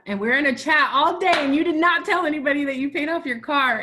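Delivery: thrilled, emphatic disbelief; excited mock scolding. Real, not acted. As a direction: scold a friend for hiding great news. Playful scolding over withheld good news.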